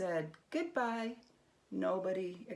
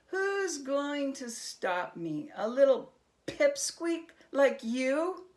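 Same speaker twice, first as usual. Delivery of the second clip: higher, slow scornful jab; mocking taunt; 6 semitones higher, 7 dB louder, slower than usual. A narrator's reading. Herschel taunting the little goblin, slow and scornful. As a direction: belittle the tiny goblin.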